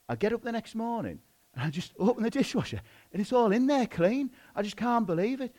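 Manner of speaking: lightly acted wonder, high-pitched; amazed husband. Husband telling wife about the magic dishwasher, mildly amazed.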